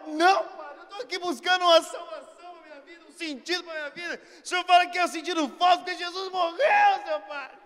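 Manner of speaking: loud, high, hammed-up protest; shouted mock anguish. Seeker confronting the priest about Jesus' death, shouting in anguish. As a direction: protest at full voice, pleading dramatically. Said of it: "de tanto que eu gritei ali".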